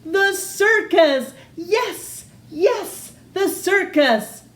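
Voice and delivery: high, loud eureka shout; excited shout. Acted. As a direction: leap up with the idea. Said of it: "she shouted with a jump".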